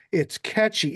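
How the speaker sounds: ordinary delivery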